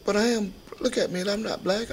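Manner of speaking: put-on high, loud insistence as a boy; indignant protest. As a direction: protest hard, head up, a boy who won't give in.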